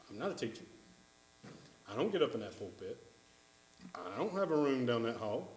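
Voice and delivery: low, quiet mimicked excuse-maker; dismissive excuse. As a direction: mutter it like a listener shrugging off responsibility. Mimics a listener making excuses.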